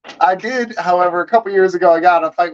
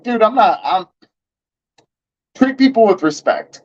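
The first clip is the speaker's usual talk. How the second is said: retold firm retort; 6 semitones higher than usual. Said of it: "And I was like".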